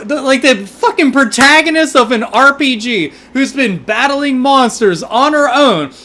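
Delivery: high, loud, incredulous ranting; can't get over that slap; astonished outburst. Real, not acted. Astonished reaction to game slap.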